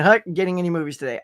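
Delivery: ordinary talk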